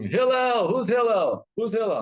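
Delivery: high-pitched calling shout; imitated scream. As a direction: holler the name like a rude stranger at the door. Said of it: "Some guy's gonna scream".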